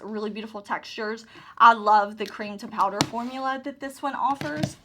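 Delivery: ordinary talk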